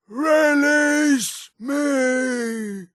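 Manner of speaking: high, extremely drawn-out cry; tormented plea. Acted. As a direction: wail the words slowly, stretching each one.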